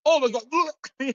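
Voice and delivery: slow, high retold disgust; disgusted shock. Man retelling finding a dump in his bucket, slow high shock. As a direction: recoil as the gross find sinks in.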